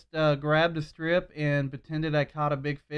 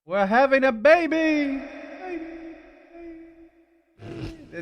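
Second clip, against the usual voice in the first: echoing announcement; 12 semitones higher, 5 dB louder than usual. Pretend. Showing off the favorite effect, echoing shout. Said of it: "That's me echoing".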